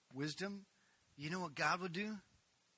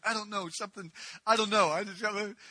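flustered; 6 semitones higher, 10 dB louder than usual. Retold or imitated. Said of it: "I was just so flustered".

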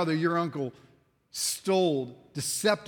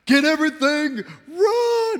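panicked yell; 11 semitones higher, 10 dB louder than usual. Pretend. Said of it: "I'd be like".

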